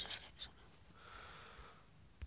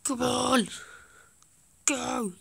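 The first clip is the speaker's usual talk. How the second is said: excited shout; 5 semitones higher, 5 dB louder, slower than usual. Real, not acted. Cheering game on.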